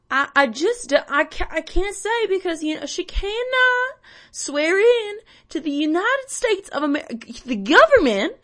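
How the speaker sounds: loud, high Southern accent, hammed up; Roy Moore. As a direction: sputter indignantly in an exaggerated Southern accent. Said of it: "I lost the accent completely".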